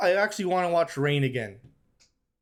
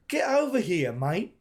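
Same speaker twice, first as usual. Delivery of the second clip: British accent; 2 semitones higher than usual. Shown as a demonstration. Gamer arguing over the accent, hammed-up British accent. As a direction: exaggerate the British vowels, voice pitched up. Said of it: "Nah, that's British".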